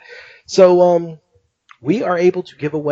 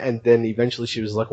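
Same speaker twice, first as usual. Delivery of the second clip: usual speaking voice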